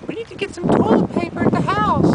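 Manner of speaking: high falsetto mock girlfriend; girlfriend's voice. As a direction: squeak it up like her girlfriend. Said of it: "she was like".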